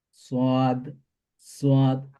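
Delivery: "সাদ" as a full, rounded "swaad"; rounded-mouth S. Shown as a demonstration. Teacher contrasts it with sin, "সাদ" with rounded mouth. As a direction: round the lips on "সাদ", even and drawn out. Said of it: "মুখটা গোলাকার হবে".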